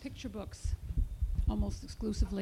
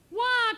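loud, high acted baby cry; high mock-crying "wah"; baby's wail; 11 semitones higher, 9 dB louder than usual. A narrator's reading. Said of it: "cried baby".

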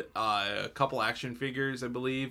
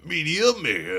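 loud show name; 6 semitones higher, 8 dB louder than usual. Acted.

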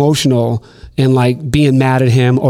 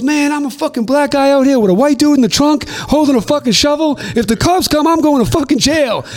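much higher, frantic mock-panic rant; imitating panicked K Slade; 13 semitones higher than usual. K Slade fearing the cops with a shovel, high frantic voice. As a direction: rant in a high, scared squeal.

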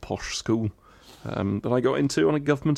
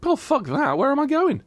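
exasperated refusal. Real, not acted. Refusing the idea of running.

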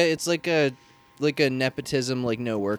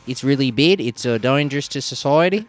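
mock Kiwi voice, a little higher; lightly put-on New Zealand accent; 3 semitones higher than usual. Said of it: "I just wanna keep doing the New Zealand voice".

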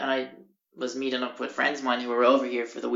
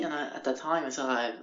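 ordinary delivery

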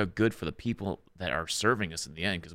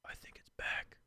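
grave hushed whisper; solemn whisper; 16 dB quieter, slower than usual. Retold or imitated. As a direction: whisper it like bad news. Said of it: "he looks at me very solemnly".

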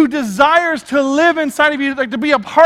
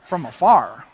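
East Texas twang; 12 semitones lower than usual. Retold or imitated. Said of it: "I keep saying it that way".

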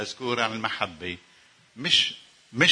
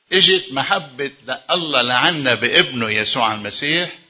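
ordinary talk

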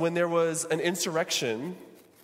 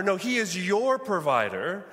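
ordinary talk, nothing marked

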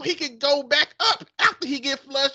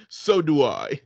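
lower, slow British man; low, slow mock British man; man's British accent; 9 semitones lower, slower than usual. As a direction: read it like a smug English gentleman. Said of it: "man and a woman accent".

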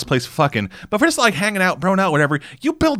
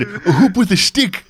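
put-on slow old man voice, raised in pitch; 6 semitones higher, slower than usual. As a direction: proudly show off an old toy. Old man bragging about toys.

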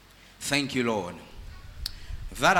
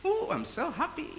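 squeaky, much higher and softer put-on voice; mock overjoyed worshipper; 13 semitones higher, 9 dB quieter than usual. Preacher mocking a churchgoer gushing about an alert, squeaky and high. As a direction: lampoon someone who must explain their joy. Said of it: "and you say".

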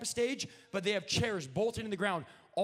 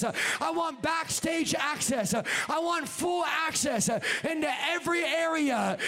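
forceful sermon, drawn-out emphatic demands; full-force preaching; 6 semitones higher, 5 dB louder than usual. Real, not acted. Preacher voicing God demanding full access, full-force preaching. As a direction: press each demand harder, phrase by phrase.

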